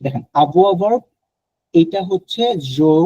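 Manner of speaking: normal talking voice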